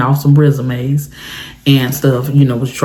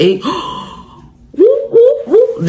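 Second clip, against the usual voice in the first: siren imitation; slower than usual. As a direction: gasp then high whooping siren. Son imitating police siren.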